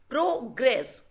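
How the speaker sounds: "progress" in two separate beats; syllable by syllable. Shown as a demonstration. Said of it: "it is actually divided in".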